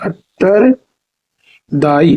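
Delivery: उत्तरदायी very slowly, broken as uttar, pause, daayi; slow practice model. Shown as a demonstration. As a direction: split उत्तरदायी with a long pause before दायी. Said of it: "practice करिए". (उत्तरदायी धीरे-धीरे तोड़कर)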